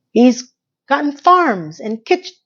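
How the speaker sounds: ordinary delivery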